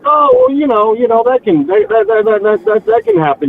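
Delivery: mock him tripping over that that that; flustered brother-in-law. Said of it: "And he's like".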